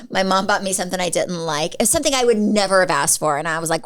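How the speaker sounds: ordinary delivery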